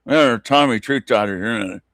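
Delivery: slow, jaw held shut; tight jaw. Retold or imitated. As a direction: mumble it through a locked jaw. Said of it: "trying to talk without moving my jaw".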